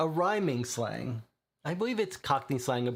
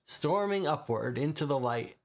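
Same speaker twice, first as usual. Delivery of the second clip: normal talking voice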